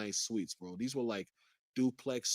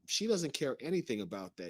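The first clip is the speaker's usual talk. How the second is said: ordinary talk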